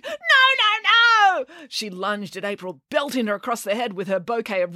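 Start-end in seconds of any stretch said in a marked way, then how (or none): 0.0-1.4 high, loud, repeated shrieks; piercingly high screaming refusal; screamed protest. A narrator's reading. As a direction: scream each word in a tantrum. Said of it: "she screamed".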